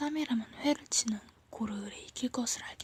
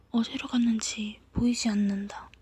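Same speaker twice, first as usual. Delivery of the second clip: usual speaking voice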